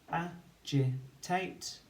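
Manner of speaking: "agitate" stretched very slowly with a rising end; slow teacher's blend, ending on a rise. Shown as a demonstration. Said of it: "we can say it as we spell it to build the word".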